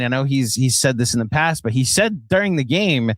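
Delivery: ordinary talk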